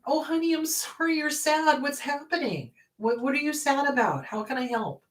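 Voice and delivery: hushed sympathetic coo; caring parent. Acted. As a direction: gently comfort a sad child. Voices caring parent to sad child.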